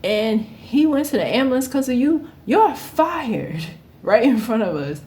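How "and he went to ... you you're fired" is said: higher, stern boss voice; owner firing her. Retold or imitated. Owner firing the worker in front of everyone, high and stern. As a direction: fire her like an angry boss. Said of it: "she was like".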